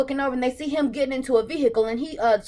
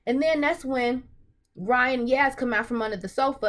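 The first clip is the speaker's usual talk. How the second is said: ordinary talk, nothing marked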